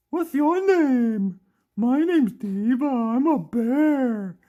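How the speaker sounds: loud, high, slow toy-bear voice; Diva. Pretend. Toy bear introduces herself to everyone, funny high voice. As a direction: belt it out high, stretching each word. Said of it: "that's how she talks".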